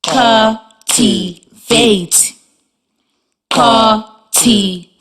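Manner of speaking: "Cultivate" drawn out slowly, one beat per syllable: cul-ti-vate; slow, clapped syllables. Shown as a demonstration. Teacher modelling "Cultivate" for the class, slow syllable by syllable. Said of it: "Your turn".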